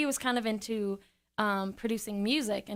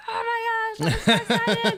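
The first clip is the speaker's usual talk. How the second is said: much higher and louder, laughing giddy squeal; thrilled; 13 semitones higher, 9 dB louder than usual. Retold or imitated. Thrilled in studio session.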